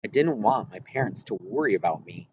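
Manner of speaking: plain reading voice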